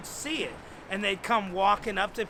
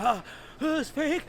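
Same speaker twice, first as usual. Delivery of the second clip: high, heaving breaths between words; calming down, panting; 7 semitones higher than usual. Retold or imitated. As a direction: gasp it out after a screaming fit. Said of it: "And he's calming down".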